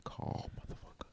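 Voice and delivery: breathy joking whisper into mic; giggly prank whisper. Real, not acted. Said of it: "Why y'all whispering into the mic".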